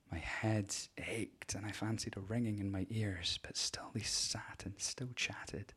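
slow, breathy near-whisper; hushed whisper. Acted. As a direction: let it sink to a whisper. Dread rising, ringing in ears.

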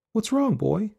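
put-on high-pitched little girl's question; girl asking. A narrator's reading. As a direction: ask your pet in a high, concerned child's voice. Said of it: "Alice asked".

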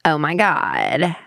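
put-on slow, drawn-out Californian Valley girl; Cali girl accent. Shown as a demonstration. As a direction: play a complaining Cali girl, stretching the words. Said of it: "we're gonna start with Cali girl".

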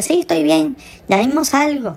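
high-pitched put-on female reply; imitated woman's voice. Caller quotes his date through the bathroom door, high imitated woman. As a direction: pitch it up as the girl answering.

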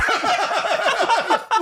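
shrieking laugh; high burst of laughter. Real, not acted. Bursts out laughing at dad story.

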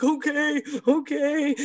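yielding anxiously, high; scared client giving in, high; nervous author. Retold or imitated. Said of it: "She's like".

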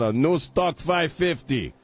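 slow, drawn-out announcer station call; radio station ID. Acted. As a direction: announce the call letters slowly, radio style. Station ID read.

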